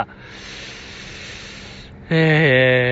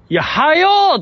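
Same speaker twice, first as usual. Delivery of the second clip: sudden high, loud yell of hurry up; fed-up shout to get moving; impatient yell; 11 semitones higher, 4 dB louder than usual. Retold or imitated. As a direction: bark hurry up like losing patience. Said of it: "最後めっちゃ声でかなるみたいな".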